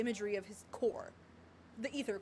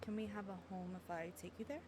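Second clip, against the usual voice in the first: low, hushed question; soft dialogue reading; 4 semitones lower, 13 dB quieter than usual. Acted. Heroine asking him about having a home, hushed. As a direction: ask it low and very softly. Reads game dialogue aloud.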